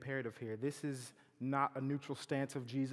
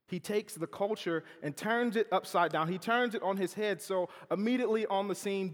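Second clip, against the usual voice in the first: preaching at full force; 6 semitones higher, 6 dB louder than usual. Acted.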